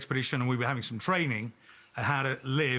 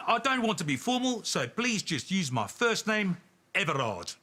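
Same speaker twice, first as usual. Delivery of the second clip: put-on South African accent; 7 semitones higher than usual. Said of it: "it's gonna be a South African accent".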